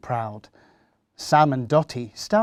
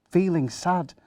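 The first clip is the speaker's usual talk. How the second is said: ordinary delivery, nothing marked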